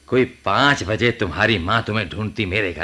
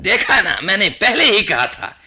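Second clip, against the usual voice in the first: higher, louder triumphant outburst; excited told-you-so; 9 semitones higher, 6 dB louder than usual. Acted. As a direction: crow that you were right all along.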